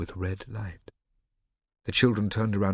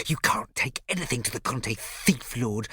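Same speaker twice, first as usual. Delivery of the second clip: higher, mocking hiss; 4 semitones higher than usual. A narrator's reading. Riccio taunting Scipio as thief lord, hissing. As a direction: sneer the title at him. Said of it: "Riccio hissed at Scipio".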